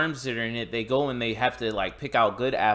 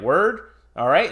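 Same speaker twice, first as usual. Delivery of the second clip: loud, higher, unfazed "Word? All right"; mock cool reply; 5 semitones higher, 7 dB louder than usual. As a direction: shrug it off, then fire. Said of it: "Saudi dude's like".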